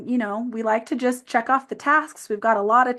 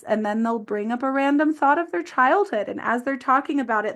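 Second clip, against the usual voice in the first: ordinary talk, nothing marked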